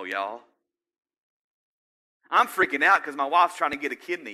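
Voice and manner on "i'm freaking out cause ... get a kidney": loud, raised-pitch mock worry; acted freaking out. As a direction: voice a worried person, louder and higher.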